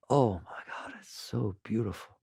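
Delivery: soft, hushed whisper of awe; whispering before a Monet; awed whisper. Pretend. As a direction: breathe it out in wonder at a painting. Admiring a painting.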